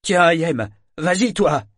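put-on high, loud call to a boy; exclaimed urging. A narrator's reading. Shipmate volunteers the boy to fight the bear, exclaiming. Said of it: "s'exclama Confrette".

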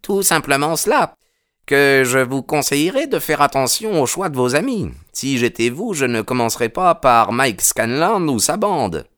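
a policeman sizing up a stranger; gruff policeman. Acted.

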